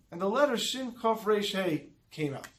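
usual speaking voice

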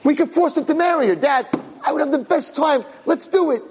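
high, excited urging; gleeful scheming. Acted. Jealous boy begging father to join plot, gleeful scheming. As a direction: pitch the plot with squealing eagerness.

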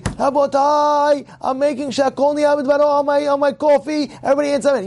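hammed-up public proclamation on a high level pitch; mock announcer. Teacher imitates a man announcing his coffee blessing, mock proclamation.

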